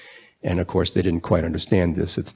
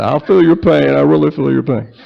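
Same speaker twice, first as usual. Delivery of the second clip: much higher and louder hammed-up Clinton; Bill Clinton impression; 7 semitones higher, 6 dB louder than usual. Preacher can't resist a louder, higher Bill Clinton. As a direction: ham up a Clinton voice, milking the pain line. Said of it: "I was gonna do a Bill Clinton impression here".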